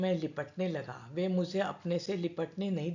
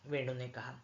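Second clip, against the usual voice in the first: plain reading voice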